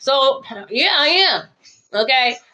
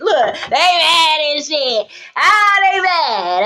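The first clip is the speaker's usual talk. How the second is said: milk their anger for laughs; gloating mock wail; 3 semitones higher, much slower than usual. Gloating over the jealous ones.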